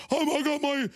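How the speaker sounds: high, put-on fake politician voice; mocking Peto O'Rourke. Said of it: "he has this fake thing".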